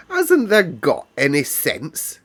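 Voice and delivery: put-on a Yorkshire girl losing patience; exasperated Yorkshire maid. A narrator's reading. Martha scolds Mary.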